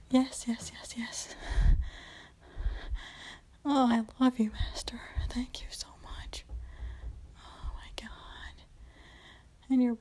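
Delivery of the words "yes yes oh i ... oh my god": much softer, breathless whispered yeses; fading whisper. Acted. Woman thanking her master in bliss, barely whispered. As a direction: sink into a hushed, spent whisper. Overwhelmed with pleasure.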